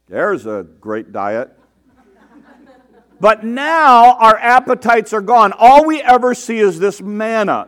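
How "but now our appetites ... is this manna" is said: complaining people. A narrator's reading. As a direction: whine about the manna like tired grumblers. People complaining about the manna.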